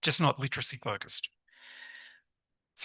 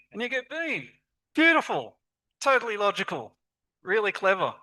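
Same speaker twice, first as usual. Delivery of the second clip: higher, gushing mock praise; over-the-top praise; 6 semitones higher than usual. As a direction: gush over the clever mistake before the catch. Mock praise of child's spelling.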